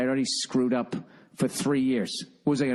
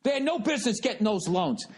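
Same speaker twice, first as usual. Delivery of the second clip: mock rant from an old crazy neighbor, pitched higher; half-laughing impression of the neighbor ranting; lightly voiced old neighbor losing it over housing loans; flipping out; 11 semitones higher than usual. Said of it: "he was flipping out going".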